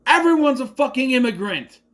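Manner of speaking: fed-up shout at anti-immigrant talk; exasperated rant. Real, not acted. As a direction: blurt it out in disbelief. Ranting against anti-immigrant talk.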